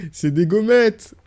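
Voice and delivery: high, loud, drawn-out delight; slow, loud cry of amused delight; delighted exclamation. Real, not acted. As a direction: burst out with a gleeful discovery. Delighted discovery of stickers, after laughing.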